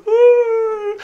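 a high, wounded little ooh; hurt child's whimper. Retold or imitated. Imitating a son's hurt ooh when scolded. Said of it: "He just goes".

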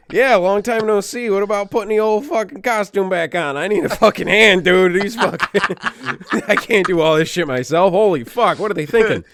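loud, high-pitched plea for backup; exasperated demand. Acted. Batman begging his old sidekick for help, exasperated. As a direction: call out in loud, fed-up desperation.

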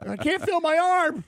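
high-pitched mock panic; re-enacted shocked wail. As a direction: wail it up high after the jolt. Shocked son complaining to his dad.

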